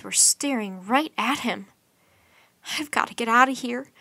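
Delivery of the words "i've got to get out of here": ordinary delivery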